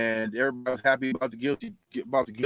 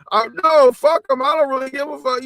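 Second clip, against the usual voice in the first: angry outburst; 11 semitones higher, 8 dB louder than usual. Real, not acted. Heated rant about Ukraine, Russia.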